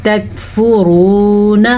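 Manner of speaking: تکفرون with a long held "roo" and short "na"; full heavy ra, long vowel in تکفرون; stretched waw. Shown as a demonstration. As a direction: draw out the waw in تکفرون very slowly. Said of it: "کھینچ کے پڑھنا ہے واو کو".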